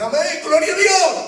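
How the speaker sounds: high crowd cry of praise; acted jubilant cheer. The people answer Moses's good news, acted jubilant cry. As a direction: shout praise up high like a rejoicing congregation.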